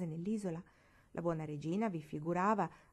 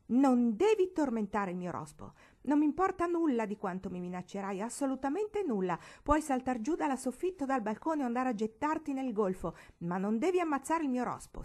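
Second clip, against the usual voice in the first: louder, higher, proud and unyielding; proud defiance; 6 semitones higher, 5 dB louder than usual. Acted. Marigold defying Barbara over the toad, proudly loud. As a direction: stand up for your toad with pride. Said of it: "con aria veramente orgogliosa".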